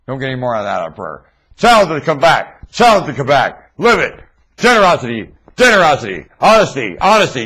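loud, chanted exhortation; preaching. Acted. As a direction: drive the refrains home like a sermon. Chanting exhortation about prayer.

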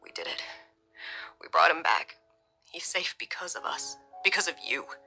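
hushed and full of feeling; soft, emotional. A narrator's reading. Father to his wife after the rescue, soft emotional voice. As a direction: say it softly, nearly whispered. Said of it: "Bryce said softly, his voice laced with emotion".

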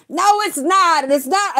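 high, loud retort; insistent shout. Retold or imitated. Mina on the phone, refusing to accept it, shouting. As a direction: shout her down like a scared friend.

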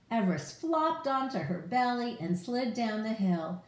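plain reading voice

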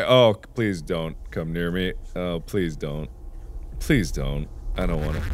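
low, soft whimpering plea; mock pleading. Player begging a skeleton not to come near, mock pleading. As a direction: beg it to stay away, playfully.

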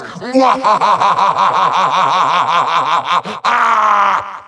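endless rolling villain cackle; long evil laugh. Acted. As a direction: laugh powerfully and evilly, drawn out. Villain gloats, promised laugh.

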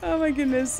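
normal talking voice

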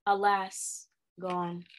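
low, hushed lament; mournful. A narrator's reading. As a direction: drop soft and low, as if bereaved. Woman feigns grief for husband.

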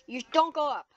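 ordinary talk

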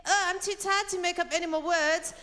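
high, tired, exasperated voice; weary French speaker. Retold or imitated. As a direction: sigh it out as a lazy Frenchman. Voicing lazy French speaker.

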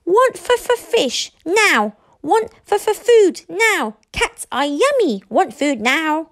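high, loud stuttering tantrum; wild dragon shouting. A narrator's reading. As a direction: shout greedy demands as a little dragon. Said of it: "wildly shouting".

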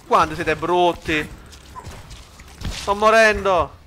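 slow, high, very loud cry of dying; desperate yell. Real, not acted. Gamer losing the fight, slow high shouting. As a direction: drag out the yell like going down.

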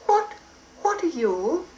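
high wary fairy; timid fairy question. Acted. Fairy asks the stranger.

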